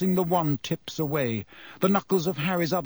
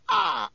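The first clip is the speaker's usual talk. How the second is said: sharp pained cry; 14 semitones higher than usual. A narrator's reading. Harry attacked by the cat.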